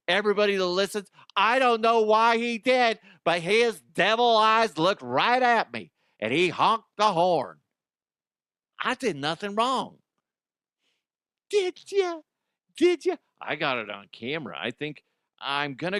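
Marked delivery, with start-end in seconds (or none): 1.4-10.0 high, louder whiny griping; mock complaining lady. Mocking the lady griping to coworkers, high and whiny. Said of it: "bitching and complaining to all her coworkers". 11.5-13.2 mocking taunt. Taunting the complaining lady.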